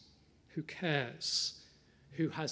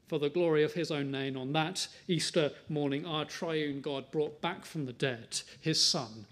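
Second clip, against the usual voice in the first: ordinary delivery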